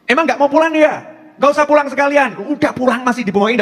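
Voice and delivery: high-pitched imitation of a scolding wife; mock angry yelling. Wife telling her husband not to come home, mock angry yelling. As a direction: rant like a wife who has had enough. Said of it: "teriakan-teriakan begitu".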